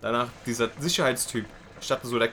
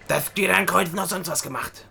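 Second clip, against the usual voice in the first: raised, higher clerk voice; snappy clerk; 4 semitones higher than usual. Retold or imitated. Office clerk questioning the applicant, officious raised voice. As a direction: imitate an officious clerk, pitched up.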